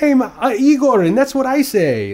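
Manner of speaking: high, loud, slow silly cartoon voice; weird cartoon character. An actor's Saturday morning TV character, imitated high, loud and slow. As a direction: ham up a goofy kids' TV Russian. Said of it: "weird Saturday morning character where he's like".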